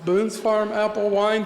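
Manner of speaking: ordinary talk, nothing marked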